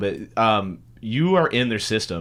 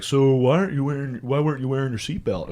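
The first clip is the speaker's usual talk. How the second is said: lightly voiced cop, raised pitch; cop role; 4 semitones higher than usual. Cop questioning a driver at the window, mock cop voice. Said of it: "he comes up and he's like".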